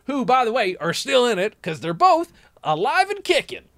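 high, excitable Texan; Texas accent. Acted. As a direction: gush as an incredulous rich Texan. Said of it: "moved to Texas and got accents".

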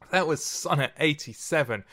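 ordinary delivery

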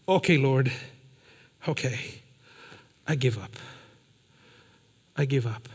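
hushed, broken giving up; quiet surrender. Retold or imitated. Friend in his truck giving up to God, hushed. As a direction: let it go softly, a beaten man.

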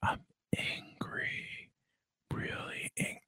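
breathy whispered pretend anger, slow; whispered mock anger. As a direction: whisper it like a fake confession of anger. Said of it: "I'm not really angry".

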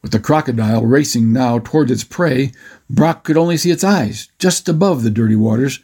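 usual speaking voice

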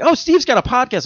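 a high, bright mock of the coworker; mock chirpy coworker. Coworker announcing the podcast, squeaky and high.

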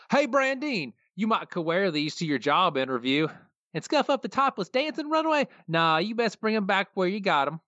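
a yokel sweet-talking his wife; high, lilting country twang; Cletus voice. Retold or imitated. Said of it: "I'll start out doing the Cletus voice".

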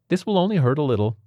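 high, quick nurse voice; brisk nurse, pitched high. Acted. Nurse speaks to patient.

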